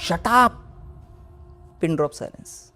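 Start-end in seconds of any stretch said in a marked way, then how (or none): 0.1-0.5 sharp high yell to silence the crows; snapping Shut up at the noisy crows; retold loud shout. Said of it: "शेवटी मी जोरात म्हणलं".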